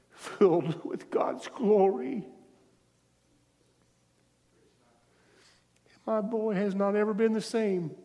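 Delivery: real tears, voice cracking high; choked up, pushing the words out through emotion; voice breaking with tears. Real, not acted. Pastor telling church about his son, voice breaking with tears. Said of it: "I don't know why I'm crying".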